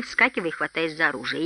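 plain reading voice

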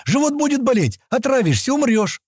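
very high, frantic warning; alarmed yelling. A narrator's reading. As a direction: scare the child off with a high-pitched cry. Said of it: "закричал он". (испуганный крик на высоких нотах)

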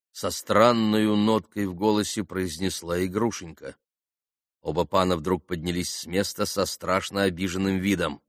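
ordinary delivery

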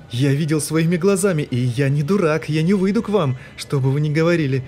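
high, loud frantic shouting; paranoid yelling. A narrator's reading. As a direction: rant in panic. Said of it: "заорал вновь тот же голос".

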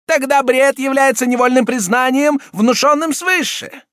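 high, loud enraged prelate; furious exclamation. A narrator's reading. Cardinal voice.

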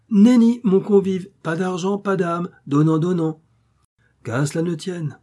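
bien plus haut et plus fort, catégorique; refus énergique. Acted. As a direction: refuser net, en haussant le ton. Le démon refuse avec force. (le démon refuse au vendeur, haut et fort)

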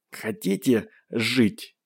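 slow, strained, sobbing with pain; speaking through pain. Acted. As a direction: force each word out through the pain. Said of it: "Говорила она с трудом, всхлипывая от боли".

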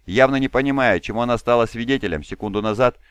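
ordinary delivery

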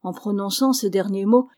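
ordinary delivery, nothing marked

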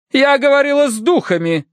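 very high, haughty put-down; curt rebuke. A narrator's reading. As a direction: cut him short in a high matron's voice. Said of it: "осадила его миссис Меринг". (осаживая, высоким властным голосом)

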